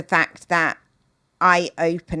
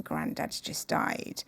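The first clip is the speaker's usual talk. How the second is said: hushed; 8 dB quieter, slower than usual. Retold or imitated. Telling daughters of grandfather's death.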